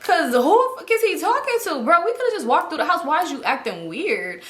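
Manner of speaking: ordinary delivery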